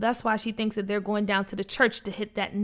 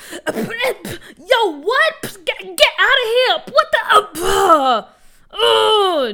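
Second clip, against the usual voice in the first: high, loud, flustered cries; yelling while sprayed; 10 semitones higher, 8 dB louder, much slower than usual. Acted. Junior getting blasted by water guns, yelling in shock. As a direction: splutter and shout as water hits your face. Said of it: "Junior yelled while getting sprayed".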